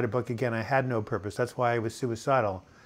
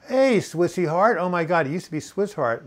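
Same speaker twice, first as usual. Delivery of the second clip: excited greeting; 6 semitones higher, 7 dB louder than usual. Real, not acted. Streamer spots his gal from Santa Cruz, excited. Spotting an old friend in chat.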